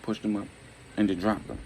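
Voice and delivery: plain reading voice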